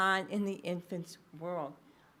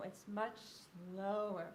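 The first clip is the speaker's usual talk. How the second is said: drawn-out "slower"; slower tempo; 5 dB quieter than usual. Shown as a demonstration. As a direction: stretch out the word "slower" to show it. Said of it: "It has a slower tempo".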